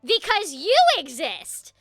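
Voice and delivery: loud, drawn-out exasperated groaning delivery; mock exasperation. Actor reacting to bad fake-accent auditioners, hammed-up exasperation. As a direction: stretch it out slowly in theatrical despair.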